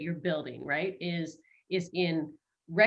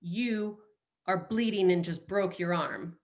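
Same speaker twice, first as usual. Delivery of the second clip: ordinary delivery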